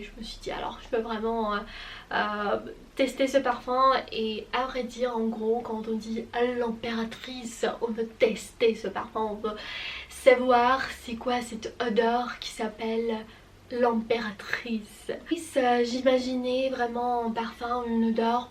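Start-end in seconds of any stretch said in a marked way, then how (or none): 0.0-0.9 soft breathy whisper. Real, not acted. ASMR aside, unvoiced. 13.7-15.1 slow whispered name. Real, not acted. Name of perfume, unvoiced.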